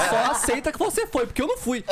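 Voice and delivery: laughing through words. Real, not acted. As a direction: tease them while still laughing. S3 jokes while laughing. (rindo, em tom de provocação)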